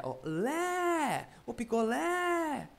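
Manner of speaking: high, drawn-out calling tune on "lé". Shown as a demonstration. Teacher shows calling the word, calling out. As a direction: sing out the last syllable as a call. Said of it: "você chamando a palavra".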